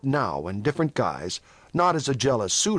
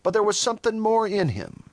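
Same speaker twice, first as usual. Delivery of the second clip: plain reading voice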